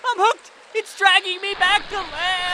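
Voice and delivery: panicked yell. Acted. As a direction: yell it as you're yanked away. Dragged by a hook.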